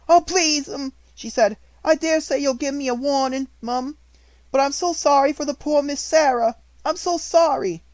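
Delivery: crying for her friend; tearful. A narrator's reading. Maid in fresh tears before the headmistress, high and weepy. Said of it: "She burst into fresh tears".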